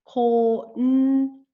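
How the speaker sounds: "Konnichiwa" loud, in five separate beats, ko-n-ni-chi-wa; syllable by syllable. Shown as a demonstration. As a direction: sound out "Konnichiwa" loudly with a long, separate n. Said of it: "It is a five-syllable word".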